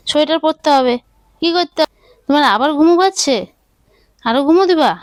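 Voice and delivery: high, sing-song motherese to a little one; baby talk. Acted. Mother coaxing her baby to pick milk or sweater, in high sing-song baby talk. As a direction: coax the baby in a high lilting voice.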